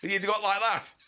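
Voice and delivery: put-on northern accent. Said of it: "I'm doing a northern accent".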